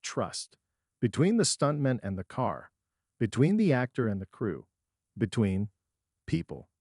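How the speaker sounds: long pause before "people"; "Between" then a pause, then "people.". A narrator's reading. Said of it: "I pause on the last word".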